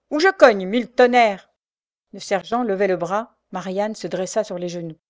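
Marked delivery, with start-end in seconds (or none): 0.1-1.5 loud, high, swearing outburst; angry threat. A narrator's reading. Sergeant raises his arm at Marianne, angry threat. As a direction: threaten and curse at full voice.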